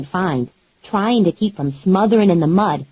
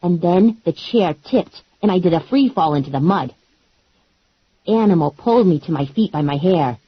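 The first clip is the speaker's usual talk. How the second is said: usual speaking voice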